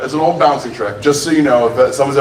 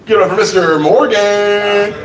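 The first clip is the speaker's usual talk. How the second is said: raised pitch, stretched-out name; stage announcer; 4 semitones higher than usual. Real, not acted. Host announces next comedian.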